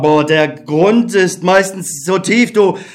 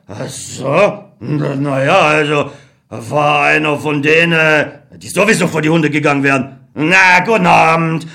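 indifferent colonel; 4 semitones lower than usual. Acted. Colonel brushing off Beckmann's death. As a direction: shrug it off and end the talk.

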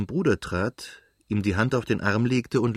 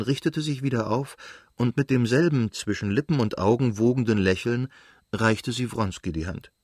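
ordinary delivery, nothing marked

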